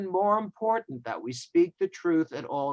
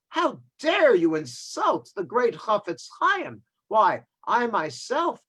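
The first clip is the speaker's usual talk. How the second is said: raised, indignant and slower; outraged rebuke; 6 semitones higher, 4 dB louder than usual. Retold or imitated. Angry passenger scolds the stranger rabbi: outraged raised voice. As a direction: scold him for insulting the great sage.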